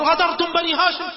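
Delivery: high, alarmed cry of betrayal; frightened cry. Retold or imitated. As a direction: cry out, startled and scared. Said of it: "من شدة الخوف".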